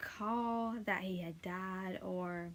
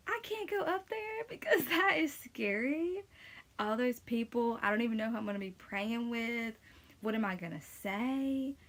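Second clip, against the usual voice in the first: mock panic; 4 semitones higher, 3 dB louder than usual. Retelling her own panicked thoughts.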